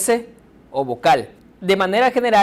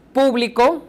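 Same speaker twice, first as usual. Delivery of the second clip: público with PÚ punched higher, then falling; stress on first syllable; 7 semitones higher, 3 dB louder than usual. Shown as a demonstration. Teacher introducing three stress patterns, stressing the start of público. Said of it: "vamos a leer con mayor fuerza".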